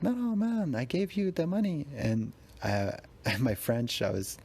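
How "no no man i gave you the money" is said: high-pitched, baffled pleading; startled, talk him out of it; squeaky disbelieving objection; confused protest. Retold or imitated. Insists he already paid.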